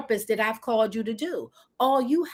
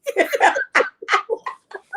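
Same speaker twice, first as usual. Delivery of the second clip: high laugh; 6 dB louder than usual. Real, not acted. Laughs at herself.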